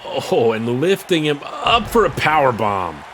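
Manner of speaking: ordinary talk, nothing marked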